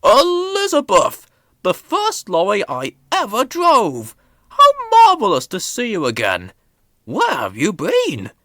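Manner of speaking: high and loud, overjoyed reunion; thrilled to see her again; delighted Fat Controller. A narrator's reading. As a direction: greet an old friend with delight. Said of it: "the Fat Controller said".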